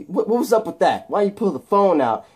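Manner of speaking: mock annoyed scolding; exasperated scolding. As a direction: tell him off in disbelief. [name] scolds Steve.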